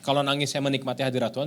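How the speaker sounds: usual speaking voice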